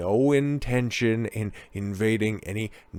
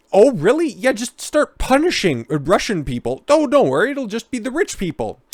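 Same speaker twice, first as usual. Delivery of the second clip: much higher and louder sarcastic voice; mock surprised dialogue; 10 semitones higher, 4 dB louder than usual. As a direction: squeal with fake reassurance. Acted exchange with EU voice.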